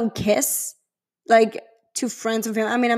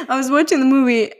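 laughing; 4 semitones higher than usual. Real, not acted. Laughing at her own crying.

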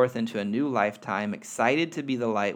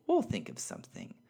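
twinkle; 4 semitones lower, 5 dB quieter than usual. Acted. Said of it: "with a twinkle".

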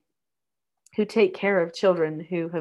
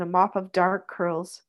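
ordinary delivery

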